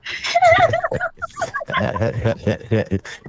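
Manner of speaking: long high-pitched burst of laughter; laughing. Real, not acted. Caller cracking up at the host's question, laughing. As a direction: break into a real, high laugh.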